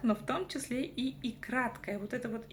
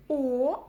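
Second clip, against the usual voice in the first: «о» громко и протяжно; выдели голосом обычное «о»; выделенная буква; 3 semitones higher, 7 dB louder than usual. Shown as a demonstration. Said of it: "нужно взять букву". (громкое учительское «о»)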